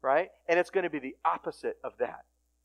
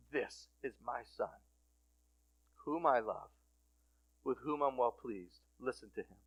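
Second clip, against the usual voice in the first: low, soft, deliberate voice from heaven; hushed solemn declaration; 4 semitones lower, 9 dB quieter than usual. Retold or imitated. God to those around Jesus, hushed and solemn. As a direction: lower the voice and let each phrase settle.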